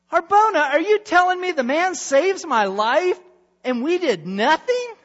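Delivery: high, loud outraged king; outraged that nothing was done; indignant king. Acted. King scolding his servant, high and loud.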